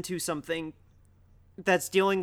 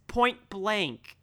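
normal talking voice

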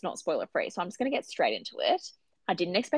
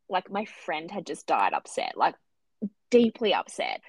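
normal talking voice

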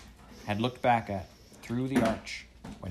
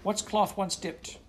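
eager question; 11 semitones higher, slower than usual. A narrator's reading. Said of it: "How splendid / Do go on".

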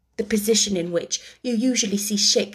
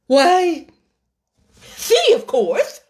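high, loud, slow, obvious; Dodo's answer; 7 semitones higher, 6 dB louder, slower than usual. A narrator's reading. The Dodo points to Alice, grand and slow.

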